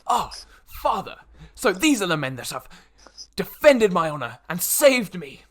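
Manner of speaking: put-on high, loud, earnest young lord; younger noble. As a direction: proudly present your rescuers to father. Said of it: "the younger noble turns to".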